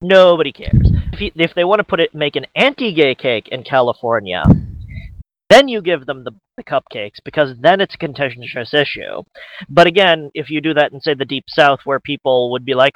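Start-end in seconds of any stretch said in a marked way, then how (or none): none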